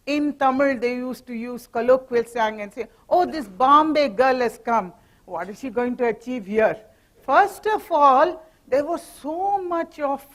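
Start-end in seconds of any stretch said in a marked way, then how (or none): none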